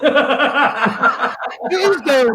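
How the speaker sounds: slow, high, laughing insistence; real laughter through the words. Real, not acted. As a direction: laugh it out at him.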